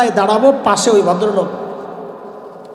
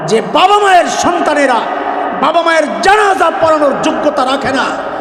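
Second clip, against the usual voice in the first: fiery preaching; 5 semitones higher than usual. Acted. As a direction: thunder at the congregation. Preacher rebukes proud parents.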